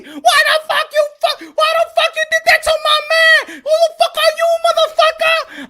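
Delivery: very high, loud screeched demands; imitated furious screaming; mock enraged woman. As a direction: act out a girlfriend attacking her rescuer. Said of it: "She started swinging at me".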